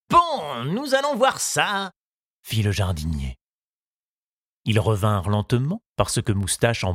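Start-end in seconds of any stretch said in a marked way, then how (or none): 0.1-1.9 gardener's voice. Acted. Gardener checks the work.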